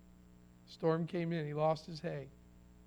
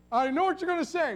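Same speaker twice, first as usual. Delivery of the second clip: eager cut-in; 9 semitones higher, 5 dB louder, faster than usual. Acted. Acting out neighbor's eager answer.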